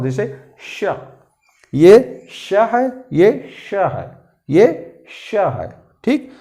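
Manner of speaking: श drawn out slowly as sh, tongue against the palate; demonstrating the sh sound. Shown as a demonstration. Teacher drawing out श slowly for students. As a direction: hold श as a long sh. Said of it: "जीभ और तालु को सटा कर बोलना है".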